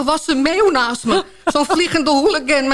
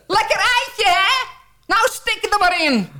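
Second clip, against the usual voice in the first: taunting yell; 9 semitones higher than usual. Acted. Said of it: "roep ik".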